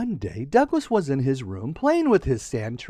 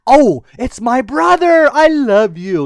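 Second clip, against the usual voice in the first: happy relief; 6 semitones higher, 9 dB louder than usual. Acted. Sister relieved to see brother.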